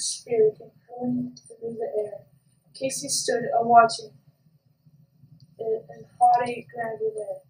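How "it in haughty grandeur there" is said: ordinary delivery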